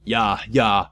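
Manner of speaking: usual speaking voice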